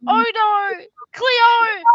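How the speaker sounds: high, loud Aussie catchphrase; mock-dramatic Aussie call; bad Aussie accent. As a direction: shout it in exaggerated Australian. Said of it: "slide into this really shit Aussie accent".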